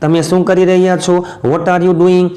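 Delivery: ordinary talk, nothing marked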